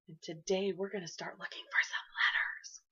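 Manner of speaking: hushed secret whisper to the class. Acted. Teacher whispering to her young class.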